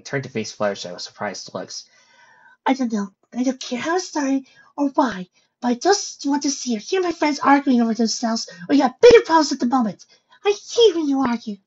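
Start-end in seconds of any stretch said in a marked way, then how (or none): 2.6-11.6 high-pitched, gentle-voiced scolding; berating. Fluttershy berating her arguing friends, high scolding voice. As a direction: scold them in a high little voice. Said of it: "she berated them".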